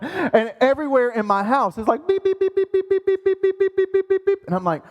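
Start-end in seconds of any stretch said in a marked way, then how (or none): 2.2-4.4 high, rapid electronic beeps; beeping imitation. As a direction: mimic alarms going off everywhere. Imitates beeping everywhere in house.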